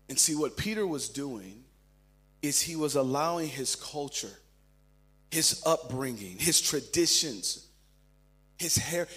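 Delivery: usual speaking voice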